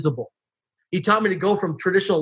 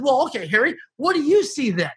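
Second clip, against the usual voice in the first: retold challenge, pitched up; confrontational; 7 semitones higher than usual. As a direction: throw the question back at the mentor, higher. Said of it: "Now I'm getting really confrontational".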